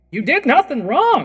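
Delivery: high, loud outburst of reassurance; Whitaker's voice. Acted. GM voicing Whitaker reassuring the party, high loud cry. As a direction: burst out as Whitaker, insisting. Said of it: "Whitaker's voice kind of come out and say".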